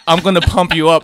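loud put-on Austrian accent; mock Austrian accent. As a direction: do a jokey Austrian strongman accent. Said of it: "I think that's the Austria".

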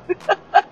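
quick high laugh; real laugh at the villain's nerve; short laugh. Real, not acted. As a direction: let a short laugh burst out.

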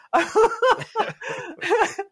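high burst of laughter; real laugh. Real, not acted. Laughs at own loins joke.

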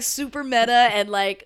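ordinary talk

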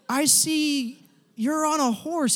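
high, very slow mock vision; imitating prophetic sharer. Somebody offers him a vision, mock-mystical and drawn out. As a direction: draw each word out high, like a seer.

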